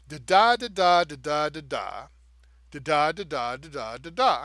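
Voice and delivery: "da" syllables alternating weak and strong, every second "da" punched loud; iambic rhythm demo. Shown as a demonstration. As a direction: beat out "da, da" with stress on every second "da". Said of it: "So if I say".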